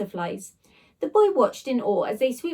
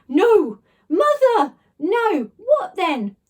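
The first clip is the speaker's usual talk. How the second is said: loud high excited guesses; excited shouting; 8 semitones higher, 8 dB louder, much slower than usual. Acted. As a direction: blurt each guess out.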